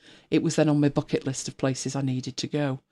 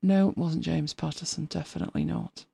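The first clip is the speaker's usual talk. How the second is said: muttered aside; 8 dB quieter than usual. Real, not acted. Muttering to herself while searching.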